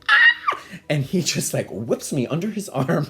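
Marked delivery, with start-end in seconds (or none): 0.1-0.6 high, loud mock scream; startled shriek played for laughs; imitated scream. Child grabbed from behind by father, mock scream. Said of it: "and I scream".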